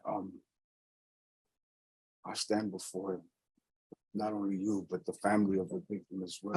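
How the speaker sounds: hushed, barely audible statement; near whisper. Real, not acted. Defendant addressing judge and victim's family, very quietly. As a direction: speak softly, hardly projecting. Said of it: "but you're whispering".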